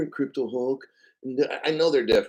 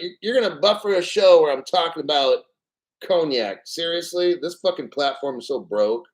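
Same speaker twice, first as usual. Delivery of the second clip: normal talking voice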